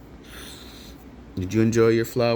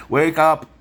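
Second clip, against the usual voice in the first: higher, louder wake-up call; 8 semitones higher, 7 dB louder than usual. Real, not acted. Calling cousin Billy to wake up, loud. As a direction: yell it to rouse him.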